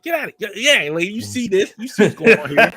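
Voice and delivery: high-pitched, increasingly loud scolding; mock indignant yelling. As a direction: imitate an outraged woman shooing someone off. Acts out woman shooing away passerby.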